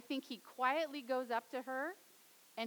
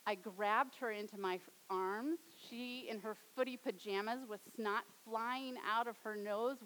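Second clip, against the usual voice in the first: normal talking voice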